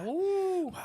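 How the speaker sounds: high cheering woo; excited woo. Real, not acted. Celebrating subscribers.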